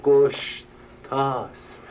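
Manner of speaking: slow, deliberate گشتاسپ, spelled out sound by sound; name correction. Shown as a demonstration. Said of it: "جناب گستاشف".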